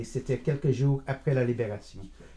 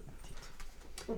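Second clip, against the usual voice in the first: whispered endearment; 17 dB quieter, much slower than usual. Acted. Calming flustered granddaughter.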